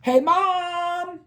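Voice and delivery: put-on high excited kid call; high, excited call to mom. A narrator's reading. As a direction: shout for mom after the win. Calling out to mom.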